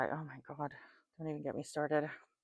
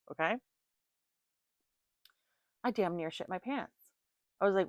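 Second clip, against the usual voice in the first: ordinary delivery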